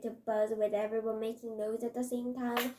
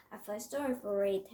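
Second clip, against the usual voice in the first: plain reading voice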